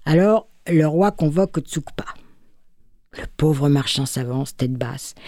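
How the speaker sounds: murmure sans voix; chuchoté. A narrator's reading. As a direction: souffler tout bas, suspense. Narration chuchotée. (chuchotement du conteur)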